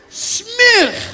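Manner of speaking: high, loud cry of disgust; disgusted outburst. Acted. Guest tastes the mashed food, hammed-up disgusted outburst. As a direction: blurt it out, hammed-up revulsion. Disgusted reaction to first bite.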